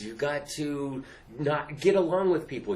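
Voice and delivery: normal talking voice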